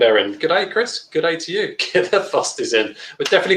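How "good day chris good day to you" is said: light put-on Australian accent, higher pitched; a playful g'day in a hammed-up Australian accent; mock Aussie accent. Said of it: "my Aussie friend".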